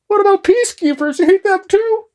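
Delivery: very high, squeaky mock-outraged voice; freaking-out Canadian. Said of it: "Anybody who is Canadian here is just freaking out".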